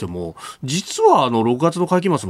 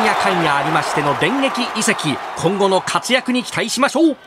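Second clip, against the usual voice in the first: sports commentator; 9 semitones higher than usual. Acted. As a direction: hype play-by-play voice.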